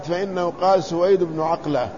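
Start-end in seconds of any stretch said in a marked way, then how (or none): 1.4-1.9 "عقلة" with throaty ayn and qaf. Shown as a demonstration. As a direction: say "عقلة" as 'aqla, with a q. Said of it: "بالعين المهملة والقاف".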